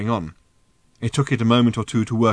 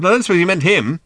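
raised, loud aside; shouting; 8 semitones higher, 6 dB louder, much faster than usual. A narrator's reading. Ford adds doubt to Arthur, shouting. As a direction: holler an afterthought. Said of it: "shouted Ford".

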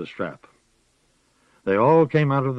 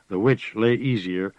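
ordinary delivery

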